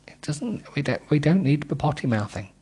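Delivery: plain reading voice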